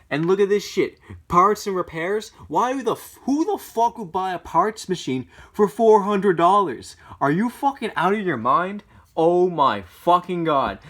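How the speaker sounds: real exasperated yelling; angry rant. Real, not acted. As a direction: spit out the disbelief at the price. Ranting at parts machine price.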